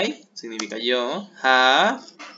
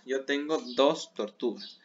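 usual speaking voice

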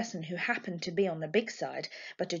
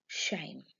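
breathy hushed whisper; whispered aside; 6 semitones lower, 8 dB quieter than usual. Acted. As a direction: drop to a secret whisper. Jess's muttered aside.

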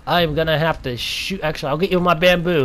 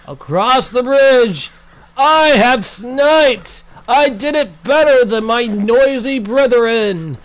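high, loud, slow victory crowing; triumphant shout; 7 semitones higher, 5 dB louder, slower than usual. Acted. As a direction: crow it like a conquering hero, drawn out. Triumphant gloating after sniping.

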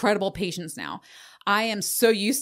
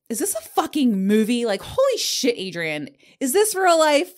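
disbelief; 5 semitones higher, 5 dB louder than usual. Retold or imitated. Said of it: "I'm like".

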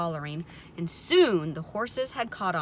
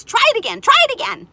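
very high, loud goose urging; excited high-pitched shout; goose crying encouragement; 14 dB louder, much faster than usual. A narrator's reading. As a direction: urge him on like a squawking goose. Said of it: "cried the goose".

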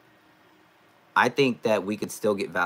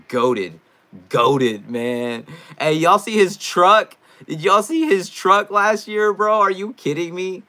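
excited hype; 9 semitones higher, 8 dB louder, slower than usual. Real, not acted. Raving about player's truck.

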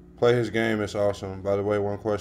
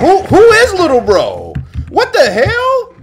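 much higher and louder, a laugh breaking through; squealing surprise; 9 dB louder than usual. Real, not acted. Streamer spotting a tiny player, high squeal of surprise. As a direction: shriek in delighted disbelief.

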